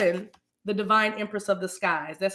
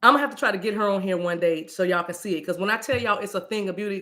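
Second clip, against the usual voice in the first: ordinary talk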